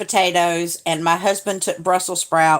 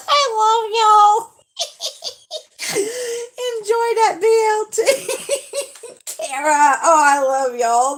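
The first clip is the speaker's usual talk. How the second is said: much higher, laughing and tearful squeals; overjoyed laughing; 11 semitones higher than usual. Real, not acted. Thanking the viewers for their gift, laughing and tearful. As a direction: laugh and cry out your thanks.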